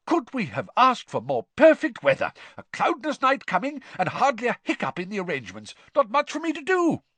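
high, loud, jovial sportsman; hearty Bagman voice. Acted. Bagman bragging about the day to Arthur, jovial and excited. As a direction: boom with wild excitement.

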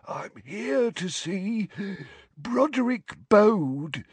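put-on high, very slow old wheeze; quavery old wizard wheezing. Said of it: "he wheezed".

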